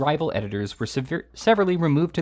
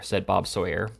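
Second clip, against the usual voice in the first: plain reading voice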